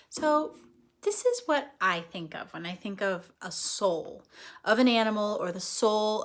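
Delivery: ordinary delivery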